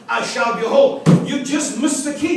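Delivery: normal talking voice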